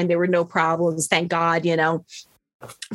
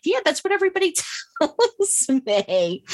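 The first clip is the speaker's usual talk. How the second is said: laughing retort; 10 semitones higher than usual. Retold or imitated. Mocking reply to anesthesiologist's promise.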